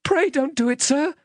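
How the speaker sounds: put-on high, frightened little boy voice; small, very high pleading voice; pleading in terror. A narrator's reading. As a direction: beg for your life as a terrified child. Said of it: "I pleaded in terror".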